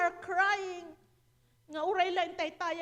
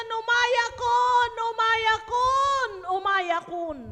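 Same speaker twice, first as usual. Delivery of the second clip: loud, higher, drawn-out cries; retold prayer cry; 4 semitones higher, 7 dB louder, slower than usual. Preacher imitating praying students to the congregation, loud prayer cry.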